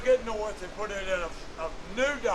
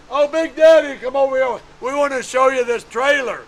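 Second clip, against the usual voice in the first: Big Daddy called out high and loud, then settling; excited greeting; 5 semitones higher, 9 dB louder than usual. Retold or imitated. A racer eagerly calls Big Daddy over to see his trailer, loud and excited. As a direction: hail him eagerly across the pits. Tony calling out to narrator.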